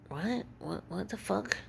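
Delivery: softly baffled, questions lifting at the end; hushed, incredulous reaction, re-enacted; retold bewilderment. Customer baffled at being accused of yelling, hushed disbelief.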